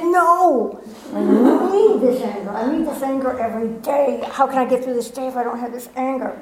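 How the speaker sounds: clinging to her anger, hammed-up; stubborn angry refusal.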